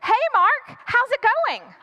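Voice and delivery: high, easy casual hello; friendly mom greeting. Acted. Ex-wife greets ex-husband, casual and friendly. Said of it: "She goes".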